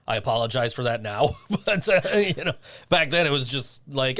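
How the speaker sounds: normal talking voice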